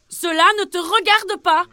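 cri plus aigu et bien plus fort, insolent; envoie-le promener en criant; crié. Acted. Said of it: "répondit Pierre". (Pierre crie au cavalier)

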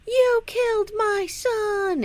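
high, slow wail of a grieving father; anguished father. Retold or imitated. Father accusing the preacher, slow high anguished cry. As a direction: cry out like a father who lost his son. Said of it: "is like".

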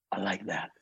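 a quiet, satisfied Clint nod in the voice; Clint Eastwood voice. Retold or imitated. Clint Eastwood approving the bodyguard's answer, low soft accent. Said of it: "in that accent".

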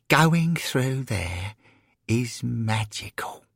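sigh, then high, slow wonder; dreamy awe. Acted. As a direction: savour it slowly, softening at the end. Florence in awe of the turnstile.

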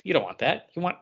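usual speaking voice

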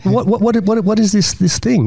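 high, tumbling eager questions; man pressing the kid, high and eager. Retold or imitated. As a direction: fire off questions, hooked. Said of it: "And I go".

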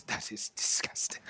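whispered revulsion; barely voiced disgust after a drink; disgusted half-orc. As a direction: whisper it, choked by the taste. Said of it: "He goes".

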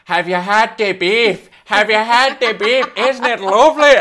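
loud, high mock Irish accent; exaggerated Irish accent, yelled at high pitch; Dutch. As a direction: bellow it in a hammed-up Irish lilt. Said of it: "his English goes Irish".

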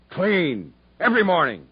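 loud, clipped commands, one word at a time; barked order. Acted. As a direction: hammer each order home. Captain orders prisoner to shave.

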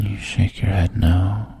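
plain reading voice

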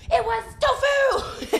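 high, slow laughing gotcha; gloating reveal. Retold or imitated. Prankster telling the dad his burger, gloating. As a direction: drag it out, triumphant. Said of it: "and be like".

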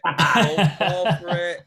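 slow, said through a laugh. Real, not acted. Friend warning a pal off a grammar trap, laughing. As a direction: crack up while warning him, slowly.

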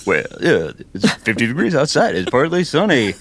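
mock DJ weather voice. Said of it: "I'd always joke with him".

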